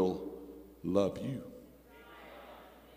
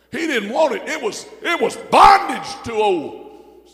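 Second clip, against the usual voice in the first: higher, louder preaching build; preaching shout; 10 semitones higher, 9 dB louder than usual. Real, not acted. Preacher building to the church, loud. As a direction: push it up with fire.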